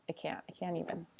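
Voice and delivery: whispered, overcome; whispered giddy aside. Real, not acted. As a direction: breathe it out in awe. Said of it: "overwhelmed delight".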